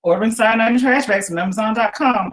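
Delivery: a hint of an assistant's even reply; lightly voiced Alexa. A narrator's reading. Alexa confirms the cat's order, lightly voiced machine. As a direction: read it back like a smart speaker. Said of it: "Alexa said".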